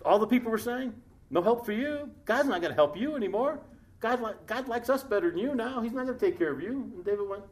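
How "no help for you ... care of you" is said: high, sneering taunt; mock him like the jeering crowd. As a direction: people gloating that God has left him.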